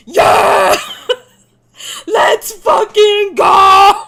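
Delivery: huge drawn-out demonic scream; wild, slow, screaming hype yell. Pretend. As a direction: roar it out like a rampaging demon. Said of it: "screaming in my best demon".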